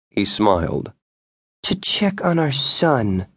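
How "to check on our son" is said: slow, cold and cutting; a chilly, deliberate reply; icily. A narrator's reading. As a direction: freeze him out with each word. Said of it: "she replied icily".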